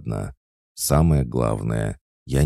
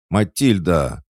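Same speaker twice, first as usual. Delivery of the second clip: loud, higher angry cry of the name; angry call; 7 semitones higher, 6 dB louder than usual. A narrator's reading. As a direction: snap her name, furious. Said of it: "Пуся злобно ударила кулаком". (злобно, громко окликая)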